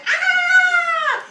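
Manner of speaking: sudden very high, very loud yelp of pain; wolf's yelp. Acted. Wolf burnt on his behind, loud high yelp. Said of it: "And he said".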